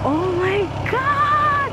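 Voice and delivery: high, slow, drawn-out gasp of awe; stunned oh my God. Real, not acted. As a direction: stretch it out in shock. Steep rough road ahead.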